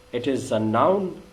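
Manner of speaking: normal talking voice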